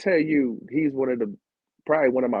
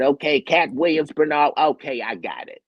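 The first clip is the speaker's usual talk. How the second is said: higher, louder celebrity impression; Katt Williams; 4 semitones higher, 4 dB louder than usual. Star working out the running order, lightly imitated raised voice. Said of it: "you can actually imitate their voices".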